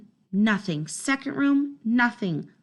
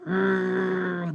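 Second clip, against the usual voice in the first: low rolling growl; guard dog growling at thieves; 3 semitones lower than usual. Acted. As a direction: snarl at the strangers. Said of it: "I snarl".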